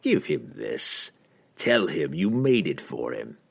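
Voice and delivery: low, slow assassin voice; softened, with a grin. A narrator's reading. Assassin handing the wizard a gift for his master, low and softened. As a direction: instruct him slowly, low and sly. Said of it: "Entreri softened his glare and grinned".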